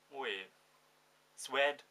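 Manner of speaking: ordinary talk, nothing marked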